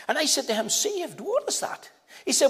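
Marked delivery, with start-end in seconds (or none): 0.7-1.9 puzzled question. Retold or imitated. Young man puzzled by new word.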